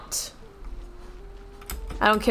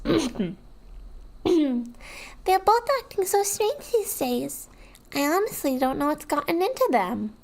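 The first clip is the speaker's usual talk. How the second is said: put-on childish voice; 6 semitones higher than usual. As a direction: play it as a small child. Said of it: "I'm not doing a childish voice".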